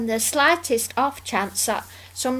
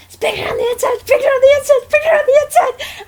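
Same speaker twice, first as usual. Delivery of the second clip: much higher and building louder, giggling; imitating Don; 8 dB louder, faster than usual. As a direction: chant it faster and more excited each time. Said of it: "how Don was going".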